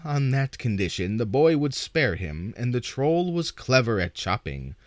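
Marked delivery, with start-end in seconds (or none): none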